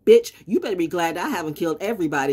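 usual speaking voice

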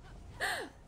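startled high gasp; sharp high gasp. Real, not acted. Streamer nearly falls off the ledge, sharp high gasp. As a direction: gasp sharply, caught off guard.

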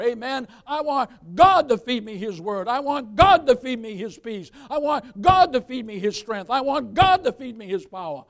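loud, emphatic preaching with drawn-out key words. Acted. Pastor assuring the congregation God will feed them, preaching.

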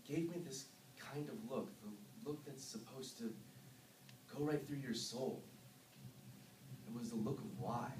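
low, soft, haunted recounting; hushed confession. Acted. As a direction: murmur it low and numb. Confessing killings of villagers.

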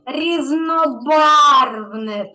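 Різнобарвне very slow, loud and emphatic; slow emphatic hint. Shown as a demonstration. Teacher prompting a child with the answer, very slow and loud.

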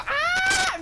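sudden high cry; startled cry. Real, not acted. Gamer cries out as his plane explodes. Blown up in game.

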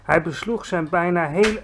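plain reading voice

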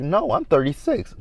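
high-pitched imitated insistence; mock stubborn denial; mimicked protest. Mimicking castmate insisting again.

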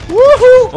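very high, loud victory shriek; shrieking jackpot winner. Real, not acted. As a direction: shriek like you hit the jackpot. Shrieks after a kill.

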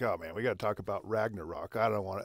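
low, soft reluctant grumble; dragging your feet, voice dropped low and soft; mock unenthusiastic aside. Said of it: "I was like".

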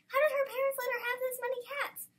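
high-pitched, amazed question; incredulous. A narrator's reading. Disbelieving thought about so many cats.

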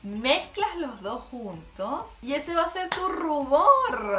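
ordinary talk, nothing marked

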